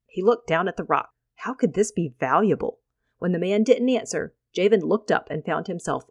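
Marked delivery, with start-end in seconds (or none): none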